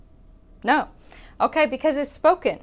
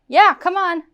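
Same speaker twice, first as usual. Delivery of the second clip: higher, louder, casual "come on"; friendly invitation; 6 semitones higher, 5 dB louder than usual. Shown as a demonstration. Teacher models what Americans say, inviting call. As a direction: urge a friend along. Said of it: "this is really what Americans say".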